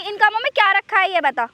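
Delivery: normal talking voice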